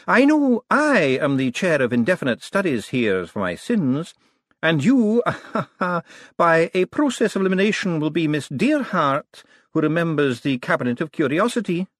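put-on high old academic voice with a chuckle; elderly wizard. A narrator's reading. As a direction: introduce yourself as an eccentric old professor. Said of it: "said an elderly wizard".

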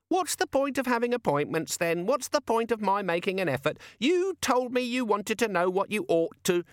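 very high, huffy little voice; sing-song gadget voice. Acted. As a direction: sulk like an offended tiny imp. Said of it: "the little sing-song voice".

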